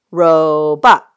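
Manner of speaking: "Robot" loudly split as ROH-bot; syllable break. Shown as a demonstration. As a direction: punch both syllables of "Robot", stretching the first. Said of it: "There's my syllable break".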